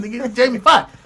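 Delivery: high, loud protest; hammed-up exasperated celebrity. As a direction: shout it as the indignant star. Retold [name] protesting.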